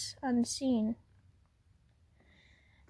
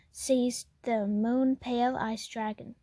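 usual speaking voice

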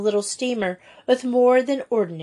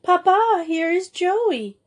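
high call, stretching out at the end; high-pitched, excited announcement; excited cry; 9 semitones higher than usual. A narrator's reading. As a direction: call out the news to a parent. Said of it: "she cried".

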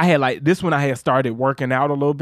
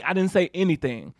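usual speaking voice

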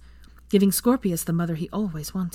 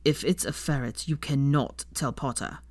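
a touch lower and softer, serious; 3 semitones lower, 4 dB quieter than usual. A narrator's reading. Draco warning Hermione seriously, slightly lower. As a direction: say it gravely. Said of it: "looking at her seriously".

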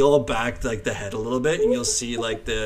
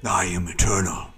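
slow, hushed otherworldly being; vast deep cosmic voice; 3 semitones lower, 6 dB louder, slower than usual. Acted. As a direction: speak like an ancient eternal mind. Mind flayer as eternal shopkeeper.

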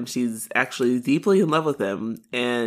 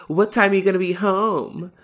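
higher-pitched calling after someone; mock yell; 6 semitones higher than usual. [name] calling after her departing sister, mild mock yell. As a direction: imitate a sister yelling after her sibling. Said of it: "yells after Erica".

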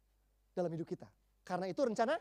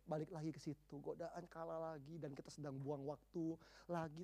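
normal talking voice

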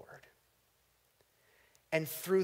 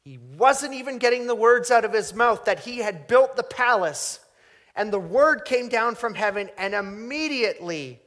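much louder and higher, racing toward immediately; preaching shout; 9 semitones higher, 13 dB louder than usual. Acted. Preacher crying out the judgment on the king, loud. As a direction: burst out with the sudden judgment.

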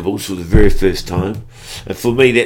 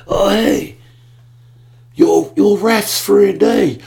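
calling down; 13 semitones higher, 6 dB louder, slower than usual. Retold or imitated. Lance quoted from chairlift.

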